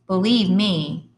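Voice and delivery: slow, pointed insistence; slow, pointed insistence in a put-on tone; insistent. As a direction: lean hard on it to your brother. Teen insisting after yelling.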